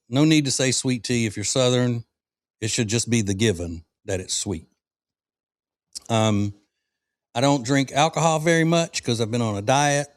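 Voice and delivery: ordinary delivery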